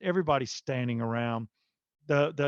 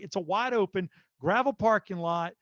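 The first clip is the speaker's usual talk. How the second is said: ordinary delivery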